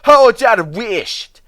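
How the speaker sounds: high, slow, stern Scottish warning; severely. Acted. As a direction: lay down the law sternly. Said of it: "said Donald severely".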